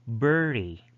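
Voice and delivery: "bury" with the R clearly sounded; pronounced R. Shown as a demonstration. Teacher showing R before a vowel, R pronounced. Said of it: "you need to pronounce the R".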